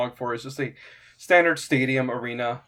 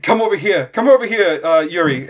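higher, loud urgent summons; excited calling out; 4 semitones higher, 9 dB louder than usual. Acted. Quintus calls the bear person over to see, excited. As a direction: call your companion over eagerly.

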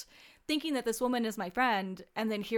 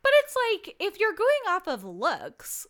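indignant; 9 semitones higher, 5 dB louder than usual. Real, not acted. Indignant rant about choices.

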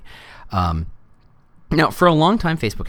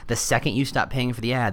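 usual speaking voice

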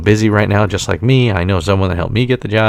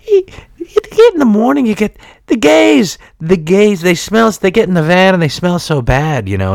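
much higher, exaggerated Irish brogue; Irish accent; 9 semitones higher than usual. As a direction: ham up a thick Irish accent. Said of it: "forgive my Irish accent".

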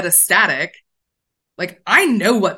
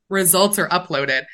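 ordinary talk

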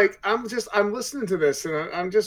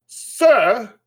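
sharp, arched "Sir?" pitched up and loud; 6 semitones higher, 11 dB louder than usual. A narrator's reading. Gwen correcting Addie, arch and indignant. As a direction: Gwen bristling at being called sir, arch and pointed. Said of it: "Gwen asked in an arch tone".

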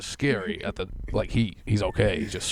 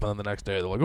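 usual speaking voice

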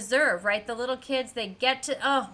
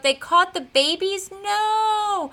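higher, louder, aghast disbelief; mock horror; 9 semitones higher, 4 dB louder, slower than usual. Viewer reacting to the kids getting caught, aghast. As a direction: cry out in shocked dismay. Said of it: "And I just wrote".

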